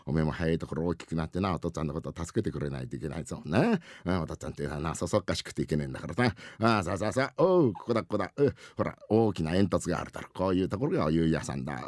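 fatherly rakugo figure. Acted. Father instructs son on the way, gently. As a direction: chat down to a small boy.

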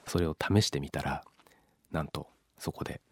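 ordinary delivery

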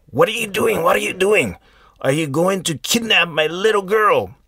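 villagers yelling. Retold or imitated. Villagers catching the man with the girl, high alarmed shouting.

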